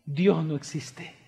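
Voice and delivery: very low, soft sermon voice with long pauses; hushed, grave preaching. Real, not acted. Preacher voices the unthinkable to the church, grave hush. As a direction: let each bleak statement sink in quietly.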